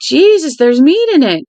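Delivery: astonished outburst, pitched well up; amazed boy. Acted. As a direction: exclaim it like a wide-eyed boy. Boy's eyes widen at dinner.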